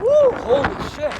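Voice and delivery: high startled whoop at the cold; startled yelp. Real, not acted. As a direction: yelp as icy wind hits. Gust of icy wind hits.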